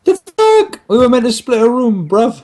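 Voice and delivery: sudden indignant surprise; shocked outrage. Real, not acted. Learns roommate plan fell through.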